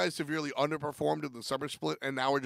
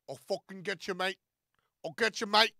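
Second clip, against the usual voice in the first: mock-English tough talk, pitched up; Funball impression; 4 semitones higher than usual. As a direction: put on a cocky English lad. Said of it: "exactly what Funball sounds like".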